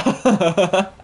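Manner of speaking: high burst of laughter; gleeful laugh. Real, not acted. Gamer laughing as the host runs off scared. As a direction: crack up at the fleeing enemy.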